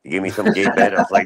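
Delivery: ordinary delivery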